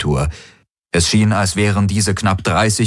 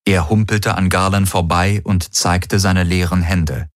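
ordinary delivery, nothing marked

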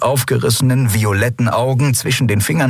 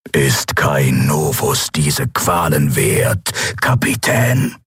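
lightly lowered, slow menacing emperor; shadow emperor; 6 semitones lower, slower than usual. A narrator's reading. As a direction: offer mercy like a cold ruler. Emperor voice again.

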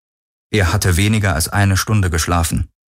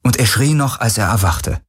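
usual speaking voice

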